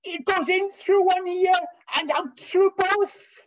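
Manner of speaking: loud, high shouting Welsh schoolmaster; chemistry master shouting at the class in a Welsh accent. Retold or imitated. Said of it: "in a Welsh accent".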